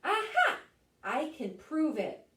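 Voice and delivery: high, loud triumphant burst; excited exclaim. Acted. Celery cries out in triumph, excited. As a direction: announce the proof with glee.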